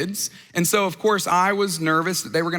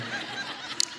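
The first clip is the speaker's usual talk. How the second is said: soft laugh; 13 dB quieter than usual. Real, not acted. Audience reaction, laughs.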